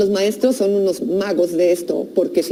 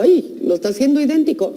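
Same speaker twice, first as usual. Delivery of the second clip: a touch of delighted parent, higher; mock proud parent; 5 semitones higher than usual. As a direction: lightly voice a parent delighted at the imitation. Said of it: "y digo". (madre encantada, voz un poco más aguda)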